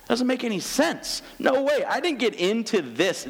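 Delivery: high-pitched baffled objection; indignant protest. Retold or imitated. Disciples objecting to Jesus's teaching, indignant.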